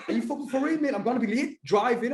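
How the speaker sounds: high-pitched, incredulous protest; mock panic, pitch shooting up; retold panic. Pleading with the driver about being late, panicked. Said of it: "I'm like, fucking panicking like mad".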